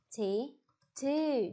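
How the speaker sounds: seventy-two stretched out slowly; slow teacher demo; slow model word. Shown as a demonstration. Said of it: "bảy mươi hai đọc thế nào nhỉ".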